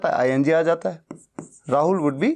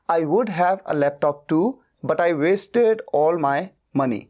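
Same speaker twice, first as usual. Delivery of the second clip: slow model sentence; much slower than usual. Shown as a demonstration. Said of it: "teacher models English sentence".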